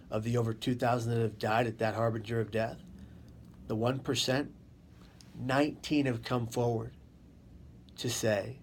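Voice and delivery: normal talking voice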